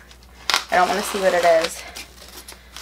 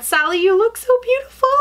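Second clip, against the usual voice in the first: high-pitched, delighted gush; adoring coo; 12 semitones higher, 3 dB louder than usual. Real, not acted. Collector talking to the Sally figurine, adoring coo. Cooing to the figurine she unboxed.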